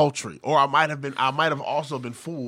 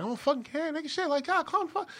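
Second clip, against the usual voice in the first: much higher, mock-exasperated yelp; exasperated retort. Brushing off a friend's nagging, a much higher exasperated yelp. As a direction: brush him off, worked up and squeaky. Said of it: "And I'm like".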